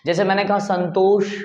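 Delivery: usual speaking voice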